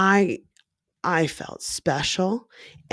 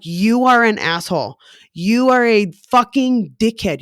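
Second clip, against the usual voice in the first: louder furious name-calling; angry retold yelling, louder; retold angry insults; 3 semitones higher, 5 dB louder than usual. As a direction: throw the insults at him loud.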